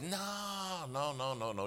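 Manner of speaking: high, drawn-out first no, then tumbling repeats; protesting denial. Retold or imitated. Prayer partner denying he wants the judge killed, high protest. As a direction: imitate an emphatic, high-pitched denial.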